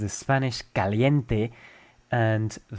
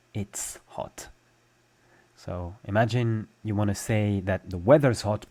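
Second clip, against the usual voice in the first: usual speaking voice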